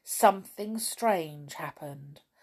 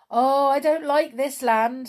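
higher, louder lament; raised storybook grumble; complaining; 6 semitones higher, 7 dB louder than usual. A narrator's reading. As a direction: exclaim with mild distress. Character complains of the land.

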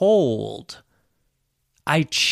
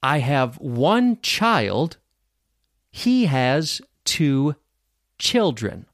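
very slow, word groups set apart by pauses; repeat-after-me model; slower than usual. Shown as a demonstration. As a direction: model it slowly for learners, pausing before the last word. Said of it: "repeat after me".

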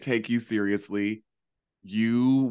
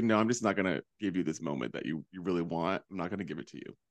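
lower, much softer refusing imitation; flat refusal; 5 semitones lower, 6 dB quieter, faster than usual. Candy refusing to give a moment, soft low imitation. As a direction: refuse coolly, almost under breath. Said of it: "she's like".

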